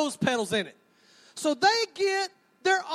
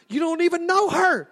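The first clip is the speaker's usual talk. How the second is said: ordinary talk, nothing marked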